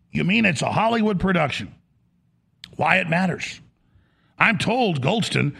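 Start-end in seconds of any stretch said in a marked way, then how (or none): none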